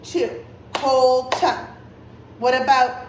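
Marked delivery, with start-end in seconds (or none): 0.7-1.7 "Colton" split into two even beats, Col-ton; louder, measured "Colton", each syllable separate; clapped-out syllables. Shown as a demonstration. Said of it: "How many times did I clap?".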